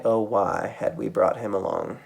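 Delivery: ordinary delivery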